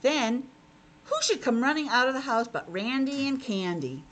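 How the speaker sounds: ordinary delivery, nothing marked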